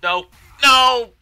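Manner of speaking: very high, very loud cries; shrieked protests; screamed dismay. Real, not acted. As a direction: scream out in panic as you lose. Dying in the game.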